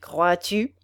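ordinary delivery, nothing marked

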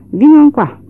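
loud, high call across the yard. Acted. Henry calling his wife from the gate, loud and high.

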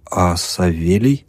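plain reading voice

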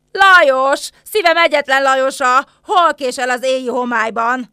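loud, impassioned cry; lovelorn calling. Acted. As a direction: call to a lover far off in the night. Calling out for absent lover. (szerelmes, hangos, epekedő hívás)

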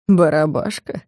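low, hushed mocking question; mocking ear whisper. A narrator's reading. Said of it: "на ухо спросил меня насмешливо".

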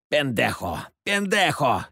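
understated narrated cry, rising on the repeat; mock yell without much volume; woman's cry. A narrator's reading. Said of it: "закричала женщина". (сдержанно изображённый женский крик)

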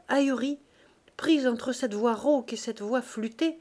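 usual speaking voice

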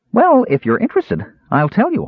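high, chirpy offer; bright and eager. A narrator's reading. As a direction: pipe up eagerly. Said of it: "offered Earwig brightly".

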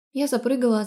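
ordinary delivery, nothing marked

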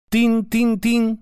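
slow, high "tyn-tyn-tyn"; imitated rapping drops. A narrator's reading. Five drops hitting a metal sheet, slow high imitation. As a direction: sound out drops rattling on metal, drawn out. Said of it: "с дробным стуком".

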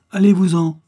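sharp, loud and high: get out; loud outraged order to leave; angry dismissal. Acted. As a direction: throw him out the door with your voice. Servant woman chasing off stranger.

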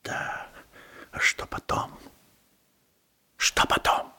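тревожный шёпот себе; прошептать с тревогой; шёпотом. Acted. Тревожные мысли вслух шёпотом. (в раздумьях, шёпотом сам себе)

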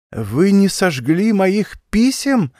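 high, slow gasp of horror; horrified cry. A narrator's reading. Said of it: "воскликнула с ужасом".

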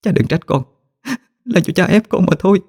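a touch of tears as she leaves; tearful. Acted. Daughter leaving home in tears, high and tearful. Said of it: "nước mắt như mưa".

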